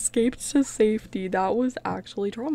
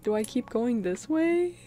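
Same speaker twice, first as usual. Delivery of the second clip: ordinary talk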